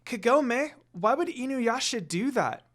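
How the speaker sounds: put-on high, lightly worried child's question; curious little girl. A narrator's reading. Rin asking Kagome, curious and worried. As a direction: ask like a small girl who's worried. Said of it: "Rin asked her curiously".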